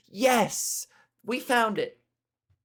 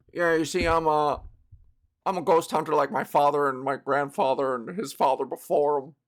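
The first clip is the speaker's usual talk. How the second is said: lower, earnest ghost hunter; 6 semitones lower than usual. Retold or imitated. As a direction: play the proud ghost hunter.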